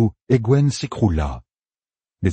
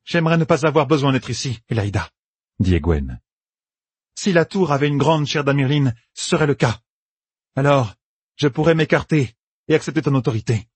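measured, mildly higher character voice; composed Egwene; 7 semitones higher than usual. Acted. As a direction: answer the usurper with calm sincerity. Egwene répond calmement à Elaïda. (ton posé et sincère)

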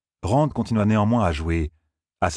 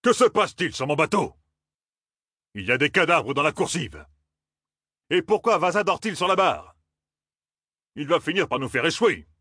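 a high, indignant skipper's voice; angry captain; 9 semitones higher than usual. A narrator's reading. Captain Malia to his crew, indignant. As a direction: demand answers, outraged.

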